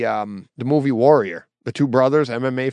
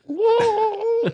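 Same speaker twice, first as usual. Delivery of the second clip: high whoa, much higher; a high choked whoa; overwhelmed whoa. Retold or imitated. Telling the story to his hockey buddy: high choked whoa. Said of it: "I'm like".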